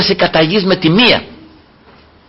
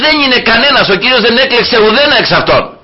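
the Lord rejecting all; 8 semitones higher than usual. Retold or imitated. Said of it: "ο Κύριος λέει".

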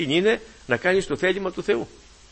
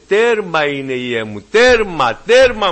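rising, louder cries of «τέρμα»; proclaiming shout; 3 semitones higher, 7 dB louder, much slower than usual. Retold or imitated. Preacher voices the Spirit's cry to the church, rising to a shout. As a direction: call it out like the Spirit crying. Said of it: "Το φωνάζει το Πνεύμα του Θεού".

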